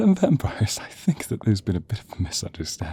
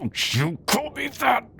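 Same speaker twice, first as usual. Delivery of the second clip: high furious outburst; outraged yell. Acted. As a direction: lash out at the insult. Villain rages at insult.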